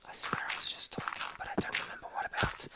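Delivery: hushed confused whisper. Real, not acted. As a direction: whisper it to yourself. Quiet aside while playing.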